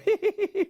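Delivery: high tittering "hee-hee"; acted fit of giggles; elephant's giggle. A narrator's reading. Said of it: "He began to giggle".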